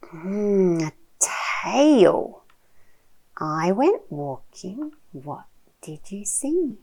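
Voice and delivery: animated, slow picture-book reading with big swoops; reading to a toddler. Shown as a demonstration. Mum sharing a picture book with her little one, animated child-directed reading. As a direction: draw out the words, playful and full of wonder.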